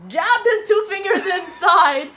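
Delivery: laughing. Real, not acted. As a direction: giggle as you describe it. Laughing recounting absurd customer.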